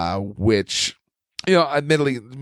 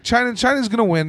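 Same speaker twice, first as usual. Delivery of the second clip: excited; 12 semitones higher than usual. Real, not acted. Declaring China will win.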